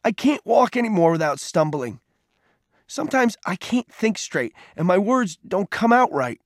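high, hurt and upset; wounded, bitter complaint; tearful anger. A narrator's reading. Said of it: "Peter's eyes welled up with tears".

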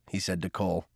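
ordinary delivery

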